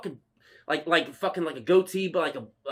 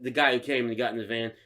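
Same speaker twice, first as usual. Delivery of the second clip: ordinary delivery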